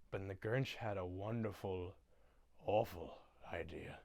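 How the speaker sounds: low, hushed narrator voice; storybook narrator. Retold or imitated. Narrator revealing the Grinch's idea, low hush. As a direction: drop low and soft, pause for suspense. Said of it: "And the narrator goes".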